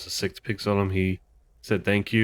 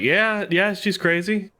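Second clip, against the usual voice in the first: high, louder pretend answer; imagined reply; 12 semitones higher, 4 dB louder, slower than usual. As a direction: play the character's enthusiastic yes in a higher pitch. Guessing how character will answer.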